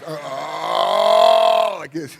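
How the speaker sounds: loud, high choked cry breaking out; welling up. Retold or imitated. To Sue on the mountain, a loud high choked cry. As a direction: let the overwhelm burst through loud and high.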